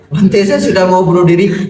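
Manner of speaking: hammed-up despair, pushed further. Repeated desperate plea.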